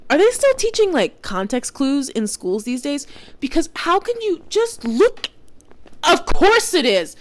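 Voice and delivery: high, loud, slow incredulous rant; real exasperation, high and loud. Real, not acted. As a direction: rant in disbelief, voice way up.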